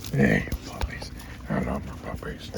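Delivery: soft breathy whisper to puppies; whispered dog praise. Real, not acted. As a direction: whisper lovingly to the dogs. Whispering love to dogs.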